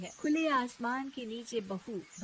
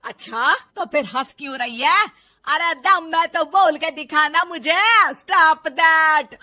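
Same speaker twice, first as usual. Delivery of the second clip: angry challenge; 5 semitones higher, 16 dB louder than usual. Acted. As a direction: hurl the challenge at her. सास ललकारती है. (गुस्से में ज़ोरदार ललकार)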